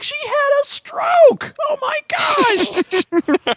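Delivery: very high, loud, drawn-out wail; mock horrified outcry. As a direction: cry it out in exaggerated shock. Acted shocked retort to wife.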